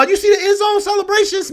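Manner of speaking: high, cracking voice of disbelief; exasperated cry. Real, not acted. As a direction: near tears in frustration. Exasperated at rival's showboating.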